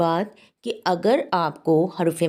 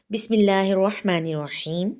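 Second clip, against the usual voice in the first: Quran recitation. Real, not acted. Teacher begins the lesson with a chanted blessing. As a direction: intone it like the start of a recitation.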